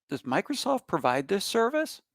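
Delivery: usual speaking voice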